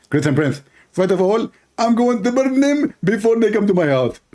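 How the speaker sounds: high, strained mock preacher voice; mocking caricature of a rival debater; Zakir Naik. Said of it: "Zakir Naik".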